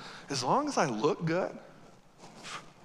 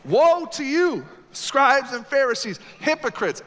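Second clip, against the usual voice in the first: forceful woe; 5 semitones higher, 7 dB louder than usual. Acted. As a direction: thunder the woe at them.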